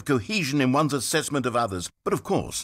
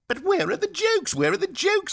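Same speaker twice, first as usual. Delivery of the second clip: high, whining mockery of a petty reviewer; whiny mock complainer.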